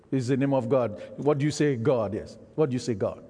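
ordinary talk, nothing marked

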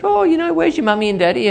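high, lightly voiced woman asking a child; kindly lady. Kind woman asking a lost toddler, high and light. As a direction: ask the little girl gently. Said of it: "and said".

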